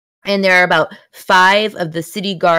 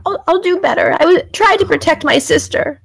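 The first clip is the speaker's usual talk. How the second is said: put-on high, earnest child voice; tearful young boy promising; little boy voice; 8 semitones higher than usual. Little boy NPC with tears.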